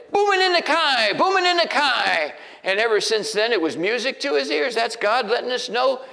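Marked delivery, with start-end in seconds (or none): 0.1-2.3 put-on much higher and louder, a child's delighted chant; excited toddler. Toddler Josh at the window during thunder, delighted high chant. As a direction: sing it out like a thrilled little boy. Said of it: "he ran over to the window and he said".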